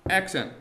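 "accent" with a clear punch. Shown as a demonstration. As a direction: hit "accent" firmly on its first syllable. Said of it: "and unaccented".

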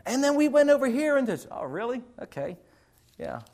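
put-on high, loud rush of kid's news; excited student. Student rattling on to a teacher who isn't listening. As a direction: gush like a kid telling a story.

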